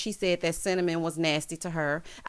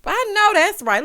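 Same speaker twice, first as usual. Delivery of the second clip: much higher, louder I know that's right; emphatic agreement; 12 semitones higher, 9 dB louder than usual. Real, not acted. Vlogger loudly backing Kima's complaint, emphatic. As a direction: cheer her on loud and high.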